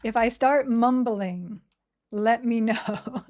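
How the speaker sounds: slow, loud, over-clear words; careful enunciation. Real, not acted. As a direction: enunciate it slowly and firmly. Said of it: "I have to enunciate better".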